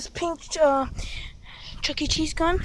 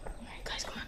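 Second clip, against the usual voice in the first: whispering; 17 dB quieter than usual. Real, not acted. Sneaking, whispering.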